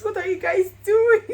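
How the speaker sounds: excited cry. Retold or imitated. Retold reaction to proposal.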